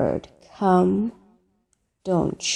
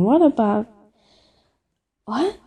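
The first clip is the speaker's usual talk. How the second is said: ordinary talk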